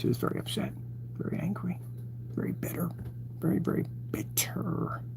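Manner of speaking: hushed, teasing whisper; mock-serious whisper; whispered mock gossip. Real, not acted. Mocking the caller's anger.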